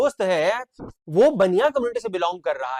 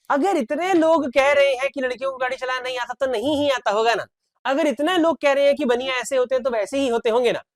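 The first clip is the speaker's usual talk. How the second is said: higher, lightly voiced naive believer; gullible crowd; 6 semitones higher than usual. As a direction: mimic someone swallowing common gossip. Teacher voices what people think.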